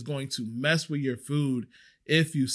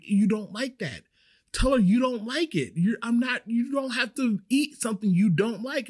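urging friend; 7 semitones higher than usual. Retold or imitated. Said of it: "And I'm telling her".

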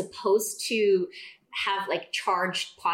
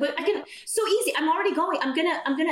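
higher, keen and insistent; eager offer; 9 semitones higher, faster than usual. Retold or imitated. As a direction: imitate herself pushing to help. Wife eagerly offering to return.

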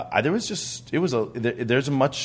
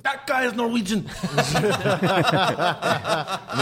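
high, laughing through the words; laughing as the Swedes object; 11 semitones higher, much slower than usual. Retold or imitated. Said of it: "they're like".

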